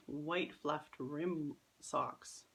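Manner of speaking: ordinary delivery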